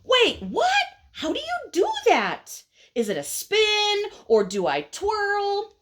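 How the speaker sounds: high-pitched flurry of baffled questions; flustered impatient caterpillar. Acted. Caterpillar asks how to build a chrysalis, flustered. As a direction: pitch it up high, all confused. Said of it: "the impatient caterpillar says".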